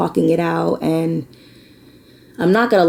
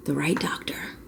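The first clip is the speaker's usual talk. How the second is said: whisper; 13 dB quieter than usual. Real, not acted. Breaks off mid-sentence, whispered.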